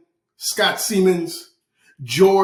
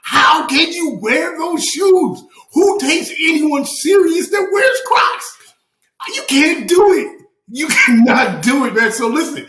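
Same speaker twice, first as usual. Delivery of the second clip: high-pitched, indignant shouting; worked-up comic tirade, voice way up; passionate rant; 8 semitones higher than usual. Real, not acted. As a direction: let it rip, outraged and incredulous. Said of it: "I just have to be passionate".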